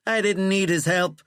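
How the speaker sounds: high, loud, indignant protest; angry denial. A narrator's reading. President denying his patron helped him, angry. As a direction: snap back indignantly. President angrily denies needing help.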